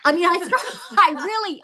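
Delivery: high, cracking laugh while speaking; laughing through tears. Real, not acted. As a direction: laugh helplessly at the memory. Retelling crying in front of the couple.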